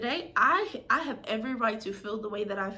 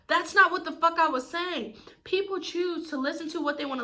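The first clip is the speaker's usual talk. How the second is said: indignant exclamation; exasperated outburst; 6 semitones higher than usual. Real, not acted. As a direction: burst out in frustration. Angry vent about ungrateful friend.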